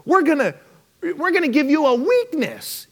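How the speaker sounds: high, booming host reveal; Oprah announcing. Retold or imitated. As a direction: pitch it up like a hyped host. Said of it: "And she said".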